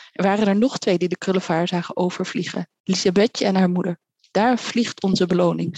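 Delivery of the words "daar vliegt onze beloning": plain reading voice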